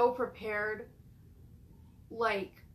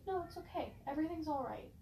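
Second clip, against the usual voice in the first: put-on higher, softer voice of a gentle old lady; sweet old lady; 4 semitones higher, 10 dB quieter, faster than usual. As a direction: soothe like a grandma knitting in her rocker. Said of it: "sweet, like, old lady".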